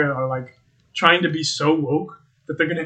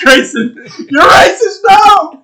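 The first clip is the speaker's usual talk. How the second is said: much higher, louder laughing yell; laughing shout; 12 dB louder, slower than usual. Real, not acted. Laughing at friend Grayson, shouting the accusation. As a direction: crack up while yelling it.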